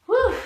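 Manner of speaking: big exhale of relief after effort; loud relieved whew. Real, not acted. End of a plank set.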